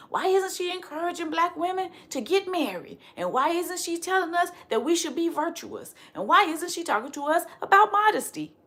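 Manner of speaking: high mock-incredulous questioning; mock critics. Voicing conservative critics.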